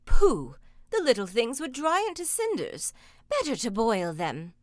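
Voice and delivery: loud scornful pooh; scoffing ogress. Acted. Ogre's wife scoffing at her husband, scornful. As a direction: wave off the idea.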